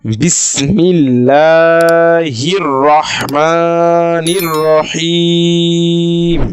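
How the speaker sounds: বিসমিল্লাহির chanted slowly with a thin লা, রহিম held long; Quranic recitation. Shown as a demonstration. Teacher reciting the full phrase for students, slow chant. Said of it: "আমরা একটা সম্পূর্ণ শব্দ দেখি".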